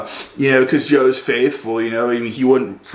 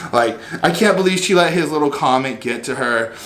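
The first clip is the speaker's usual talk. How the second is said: ordinary delivery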